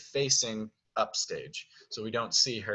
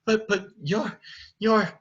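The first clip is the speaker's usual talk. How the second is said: stammering shock; 10 semitones higher than usual. Acted. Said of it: "so she's like".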